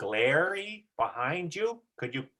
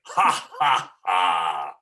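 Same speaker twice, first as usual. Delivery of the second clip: loud shouted laugh with a deep final ha; shouted throaty laugh; 6 semitones lower, 5 dB louder, much slower than usual. Shown as a demonstration. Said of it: "Really feel it in your throat".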